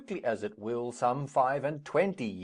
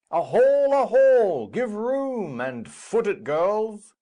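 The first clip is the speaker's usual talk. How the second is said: loud, high festive shouts across the hall; calling out; 10 semitones higher, 9 dB louder than usual. Acted. Capulet shouting to guests to clear the floor, loud and high. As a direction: call the whole room to clear space. Capulet calls for room to dance.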